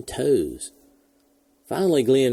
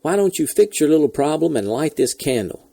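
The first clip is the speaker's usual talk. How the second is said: louder and higher, pushing them to hurry; impatient demand; 6 semitones higher, 4 dB louder than usual. A narrator's reading. Astronaut stuck on his back demands launch, annoyed. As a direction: get on with it, annoyed. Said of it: "he barked".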